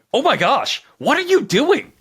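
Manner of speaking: high, startled mock yelp; imitating Buck's panicked overreaction. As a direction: jump out of your skin, hammed up. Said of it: "Buck reacts by going".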